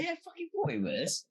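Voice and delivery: very high, excited confirmation; excited squeal. Retold or imitated. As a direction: squeal it out, delighted you were right. Retold reaction to spotting the trainer.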